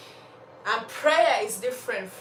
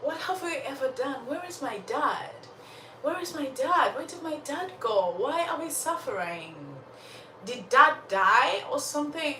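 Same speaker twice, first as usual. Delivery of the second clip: put-on higher-pitched child pleading for answers; anxious child's questions, pitched up; questioning child; 5 semitones higher than usual. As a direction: plead like a child wanting to know. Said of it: "So Sorrow looks to the mom and says".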